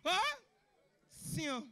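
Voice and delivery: mock sneeze, short and restrained; swaggering big-man sneeze. Comedian shows how big men sneeze, with swag. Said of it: "they sneeze with swag".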